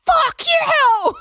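high, loud shouted curse; high pitched shout. Retold or imitated. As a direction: yell it back at her. Boss curses back.

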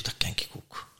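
voiceless whisper to himself; whispered thought. Retold or imitated. Watching the speaker on stage, a private whisper. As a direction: whisper it with quiet confidence. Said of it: "ik zeg".

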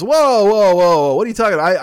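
loud, high alarmed whoa; mock shocked client. Client who wanted free art hears the rate, loud high mock alarm. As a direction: recoil at the price like a cheapskate.

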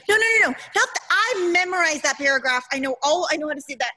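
high, rushed pleading of a retold objection; insistent protest. Actress objecting to her monologue being cut, insistent and high.

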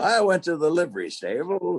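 put-on high old Western townsman; twangy frontier old-timer; Western guy. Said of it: "that Western guy".

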